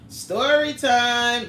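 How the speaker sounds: drawn out slow, loud and high; story time announcement. Real, not acted. Announcing story time to her chat, slow and loud. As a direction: announce it like the show's opening. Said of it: "Y'all wanna hear the story time?".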